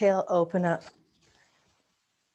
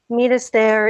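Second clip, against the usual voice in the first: usual speaking voice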